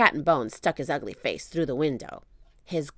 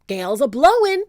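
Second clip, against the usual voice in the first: high, loud pirate call; pirate warning; 9 semitones higher, 10 dB louder than usual. Acted. Pinky calls out about the gale, loud. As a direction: shout over the wind. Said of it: "said Pinky".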